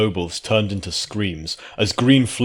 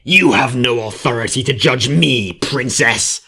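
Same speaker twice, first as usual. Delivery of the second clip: louder, spitting creature voice; spat; 4 semitones higher, 6 dB louder than usual. A narrator's reading. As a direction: spit it at the princess. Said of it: "the changeling spat".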